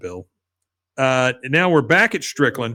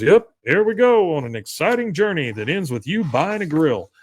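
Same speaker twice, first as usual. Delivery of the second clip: higher, swooping mock sales pitch; schmoozy salesman; 4 semitones higher, 3 dB louder than usual. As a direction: sell the journey with hammed-up enthusiasm. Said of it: "the schmoozy salesman".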